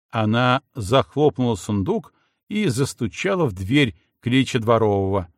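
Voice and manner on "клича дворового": usual speaking voice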